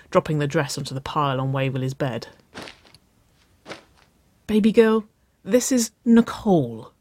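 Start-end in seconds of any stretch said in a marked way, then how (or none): 4.5-6.9 high, emphatic exclamation; exclaiming. A narrator's reading. Sister stressing who the date is, exclaiming. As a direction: urge it with raised pitch. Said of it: "Wynona exclaimed".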